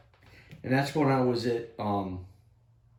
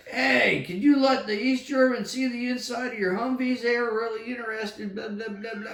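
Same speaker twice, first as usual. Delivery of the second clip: put-on drunk guards; 10 semitones higher than usual. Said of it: "and they're like".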